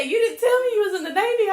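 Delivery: quick, high and loud, laughing through it. Real, not acted. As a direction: rattle it off while cracking up. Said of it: "I'm fucking dying laughing".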